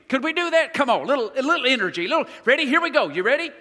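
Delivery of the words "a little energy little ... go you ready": ordinary delivery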